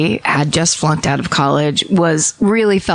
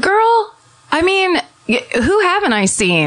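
put-on high, squealing "Girl" as the cool aunt; high-pitched aunt bragging to her niece; know-it-all aunt; 12 semitones higher than usual. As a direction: play the aunt who has seen every band.

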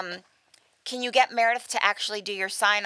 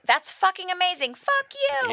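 high, impressed exclamation rising higher; exclaiming; 8 semitones higher than usual. Shown as a demonstration. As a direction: show off the word with thrilled inflection. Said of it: "depending on the inflection".

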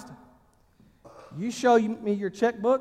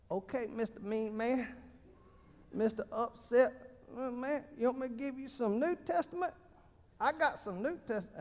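put-on tough voice; 3 semitones higher, 13 dB quieter than usual. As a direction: taunt them like a schoolyard tough. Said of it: "sometimes I just slip in and start talking like that".

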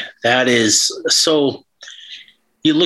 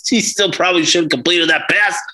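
laughing complaint; 6 semitones higher than usual. Real, not acted. Bitter joke after a laugh.

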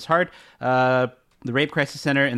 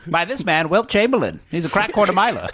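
1950s narrator; 5 semitones higher than usual. Pretend. Old-timey newsreel bit.

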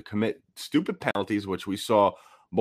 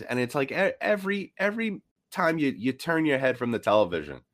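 exasperated rant; 7 semitones higher than usual. Real, not acted. Ranting about repeated penalties.

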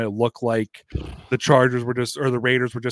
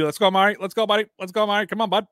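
higher, chanting bench cheer; Canadian hockey player; 8 semitones higher, faster than usual. Retold or imitated. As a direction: chant at a buddy like a hockey bro. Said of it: "welcome to Canadian hockey players".

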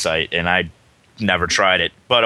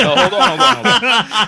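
laughing; 14 semitones higher, 6 dB louder than usual. Real, not acted. Laughing while fixing the mic.

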